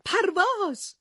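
Superman springs up crying out; mild high-pitched yell; shouted. A narrator's reading. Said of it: "فریاد کشید".

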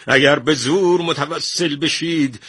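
high-pitched outburst building to a shout; agitated. A narrator's reading. Painter answers the minister's threat, agitated and rising. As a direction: swear back at him, incensed. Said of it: "که برآشفته بود جواب داد".

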